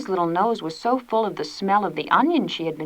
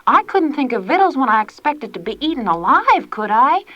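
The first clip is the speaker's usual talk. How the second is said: higher, mildly pleading excuse; 6 semitones higher, 4 dB louder than usual. A narrator's reading. Prue pleading her excuse to Tilly. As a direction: beg to be forgiven. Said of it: "pleaded poor Prue".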